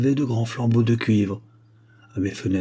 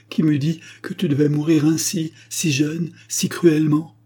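plainte aiguë entre les sanglots; cri sanglotant; 6 semitones higher than usual. Acted. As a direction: se lamenter, plus haut, sans retenue. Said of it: "et s'écria avec des sanglots". (mère pleure son fils mort, plainte sanglotante)

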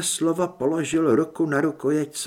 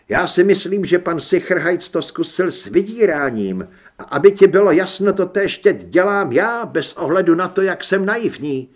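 higher, loud and insistent; defiant threat; 4 semitones higher, 8 dB louder than usual. A narrator's reading. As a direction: throw the threat back at him forcefully. Son blackmails his father back. (vzdorná, hlasitá výhrůžka)

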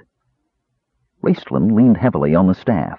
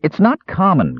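high, raised protest; cried out; 10 semitones higher than usual. Acted. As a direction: yelp it back in disbelief. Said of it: "cried Earwig".

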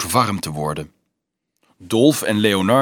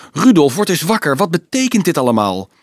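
high, loud, insistent questioning; urgent demand; 6 semitones higher, 7 dB louder than usual. A narrator's reading. Leonardo shakes dazed Dolf by the arm, urgent. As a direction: shake him awake with your voice.

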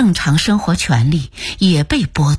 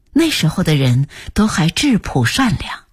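ordinary delivery

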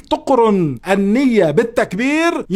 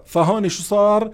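ordinary talk

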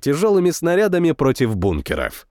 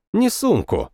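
ordinary delivery

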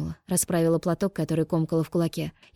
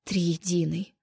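sighing; much slower than usual. A narrator's reading. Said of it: "вздыхала Осянка".

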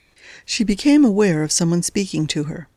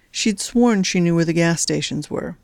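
plain reading voice

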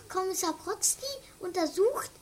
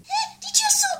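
higher, louder alarmed voice; alarmed cry; 10 semitones higher, 11 dB louder, much slower than usual. Acted. Betty finds the door locked, alarmed.

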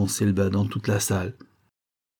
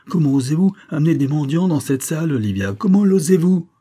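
furious shout; 7 semitones higher, 4 dB louder than usual. A narrator's reading. Baronet raging at his wife.

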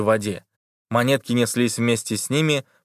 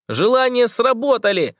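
laughing shout; 12 semitones higher than usual. A narrator's reading. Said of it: "хохотал Тоха".

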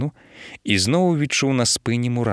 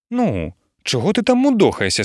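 higher, louder impatient nudge; impatient wife; 8 semitones higher, 3 dB louder than usual. Acted. Wife hurrying her hesitant husband to bed, impatient. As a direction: hurry him up, annoyed. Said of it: "спитала Олюня".

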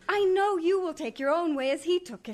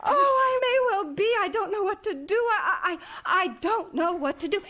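distressed; 6 semitones higher than usual. Acted. Wife in distress over arrest.

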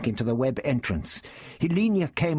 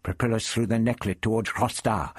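plain reading voice

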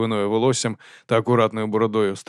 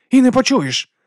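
high, loud show of triumph; forced glee; 13 semitones higher, 6 dB louder, slower than usual. A narrator's reading. Woman feigns joy over the duke's son, gleefully. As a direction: crow it with put-on joy. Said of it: "сказала вона з радістю, якої не відчувала".

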